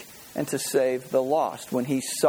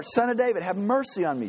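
high, pleading call, mildly raised; shouting out; 6 semitones higher than usual. A narrator's reading. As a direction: call out for mercy over a crowd. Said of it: "he shouted all the more".

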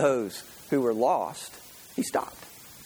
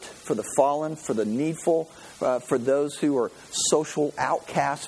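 normal talking voice

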